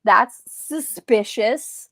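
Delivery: slow, drawn-out suspicious tone; playfully suspicious, dragging each word; mock suspicion. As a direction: stretch it out like you smell a rat. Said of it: "and I was like".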